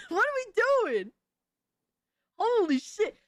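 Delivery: high incredulous question through a laugh; exasperated, voice leaping up with laughter; laughing disbelief. Real, not acted. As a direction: crack up while protesting. Laughing disbelief at spaghetti habits.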